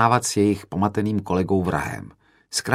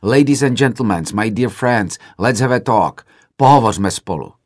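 calling out; 3 semitones higher, 6 dB louder than usual. A narrator's reading. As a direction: shout over a departing audience. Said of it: "Křikl jsem".

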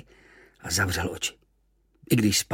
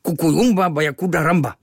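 high, loud scowling grumble; frowning; 7 semitones higher, 7 dB louder than usual. Acted. Kvíkvék frowning at his friend, grumbling. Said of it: "mračil se Kvíkvék".

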